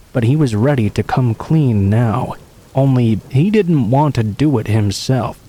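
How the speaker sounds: plain reading voice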